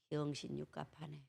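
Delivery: very soft, low muttered jibe; hushed dialect aside. Acted. Preacher shares impolite Chungcheong saying, hushed aside. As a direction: slip it out under your breath. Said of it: "공개적인 언어는 아니지만".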